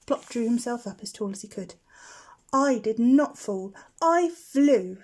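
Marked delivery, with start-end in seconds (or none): 2.5-5.0 louder, slow, proud correction; a small owl insisting on his dignity; indignant little owl. A narrator's reading. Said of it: "he said".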